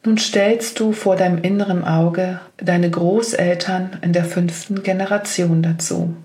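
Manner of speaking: ordinary delivery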